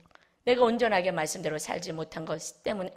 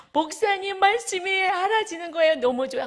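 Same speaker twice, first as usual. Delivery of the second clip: much higher, louder, bright put-on voice; delighted testimony; 11 semitones higher, 6 dB louder than usual. Congregant tells the pastor the Word is understood, delighted. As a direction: gush with joy like the congregant.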